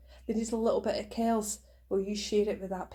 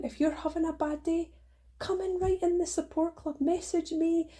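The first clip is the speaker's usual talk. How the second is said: ordinary talk, nothing marked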